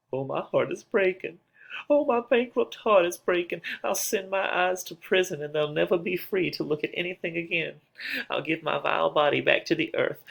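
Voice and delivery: put-on a grieving young bride crying out in despair; heartbroken Juliet. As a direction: let the heartbreak lift the voice high.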